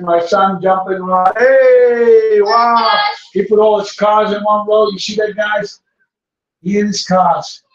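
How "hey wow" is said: excited praise. Real, not acted. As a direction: cheer your son on for his toy cars. Son lines up his cars.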